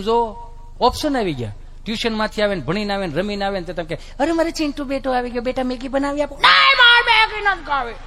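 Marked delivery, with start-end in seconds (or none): 4.2-6.4 higher, sweet mother coaxing her son; doting mother. Retold or imitated. 6.4-7.9 much higher, louder, slow bratty refusal; whiny child. Retold or imitated.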